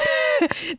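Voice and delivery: a light, high giggle; high soft giggle. Real, not acted. Host giggling at the baby joke, high and soft. Laughing at her own joke.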